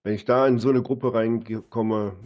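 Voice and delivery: ordinary talk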